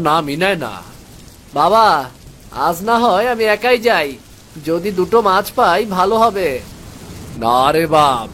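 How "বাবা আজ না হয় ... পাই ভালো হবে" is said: put-on higher boyish voice, eager; a boy offering to go fishing alone; young son. Son begging his father to let him fish, higher boyish voice.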